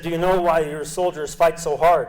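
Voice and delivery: put-on light Russian accent on the officer's question; a hint of a Russian officer asking. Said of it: "the Russian accent".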